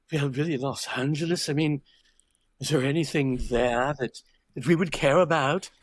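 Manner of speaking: lightly put-on British accent for a snooty New Yorker; mock a snobbish New York publisher in a British accent; snobby British accent. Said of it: "I'm doing a snobby New Yorker with a British accent".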